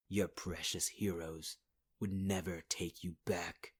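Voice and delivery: ordinary talk, nothing marked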